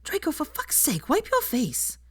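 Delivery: high, sharp hissed scold; hissed urgently. A narrator's reading. Hermione hisses at Draco to wipe his face, sharp and high. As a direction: hiss at him to clean up. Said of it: "then hissed".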